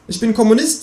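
high, proud kangaroo. Acted. The kangaroo declares its politics in a high voice. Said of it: "sagt das Känguru".

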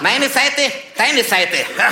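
loud, high mock declaration; territorial classmate. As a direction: stake out the desk loudly. Seatmate marks off desk territory.

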